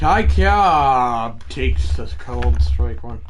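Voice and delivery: slow, drawn-out play-by-play; announcer call. Pretend. A kid narrating his game to viewers, announcer voice. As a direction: call the pitch like a broadcaster.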